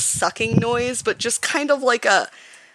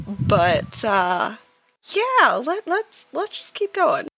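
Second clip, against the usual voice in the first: higher-pitched character voice, hesitant; Snow, recovering; 6 semitones higher than usual. Acted. Snow answers worried companions after being hurt, higher hesitant voice. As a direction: play a shaken heroine insisting she's okay, pitched up. Player speaks as Snow, reassuring party.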